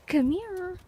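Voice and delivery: very high, soft coaxing call; cat-calling voice. Real, not acted. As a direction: sweet-talk the kitty in a high sing-song.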